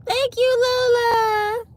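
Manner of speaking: high delighted thanks. Real, not acted. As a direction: squeal with delight. Flattered by a compliment.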